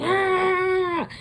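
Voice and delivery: high, loud mock roar of a corpse; a cadaver bursting out with a loud Ah; monster scream. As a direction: let out a wild creature yell.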